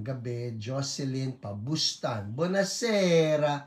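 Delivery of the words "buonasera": lightly hammed-up Italian buonasera; a hint of Italian flair on buonasera; Italian accent. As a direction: greet them like a playful Italian host. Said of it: "Buonasera with the matching accent".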